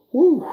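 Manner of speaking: loud tired whew. Real, not acted. Exhale after giving advice.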